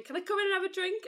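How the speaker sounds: jokey northern English voice for the skeleton horse; northern accent. Retold or imitated. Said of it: "you're giving it a northern accent".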